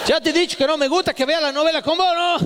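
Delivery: mucho más agudo, quejándose a los gritos; llamá a la mujer indignado por el hijo; padre exasperado. Retold or imitated. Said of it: "padre le grita a la esposa". (el padre le reclama a Mari por el hijo, agudo)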